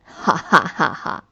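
low breathy chuckle; deep grandfatherly laughter; hearty old man laugh. Acted. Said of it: "突然笑了起来".

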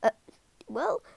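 flustered, high, slow halting reply; teacher stunned by a right answer; shaky surprise. Acted. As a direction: stumble over the words, caught off guard. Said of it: "he said shakily".

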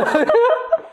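high giggle burst; real high-pitched laugh; burst of laughter. Real, not acted. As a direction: laugh at your own story.

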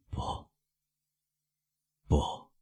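soft, strangled no; barely gets the words out, stunned; choked stammer. A narrator's reading. Prosecutor, cornered, chokes out a soft denial. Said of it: "用憋住的声音痴痴地说".

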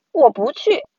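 ordinary delivery

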